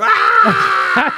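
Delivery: high, loud shocked yelp from the cold; sudden high yell of shock; cold-water shriek. Retold or imitated. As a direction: gasp out a scream as the icy water hits. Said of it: "you just go".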